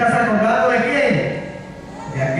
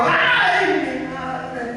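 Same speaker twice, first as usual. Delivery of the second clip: acted anguished cry; 4 semitones higher, much slower than usual. Rich man in torment in the fire, mock anguished wail. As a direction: wail high, then let it die away.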